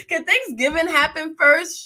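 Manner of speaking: laughing through a high, loud plea; laughing complaint. Real, not acted. Fed-up host laughs while begging Thanksgiving to come first. Complaining about early carols.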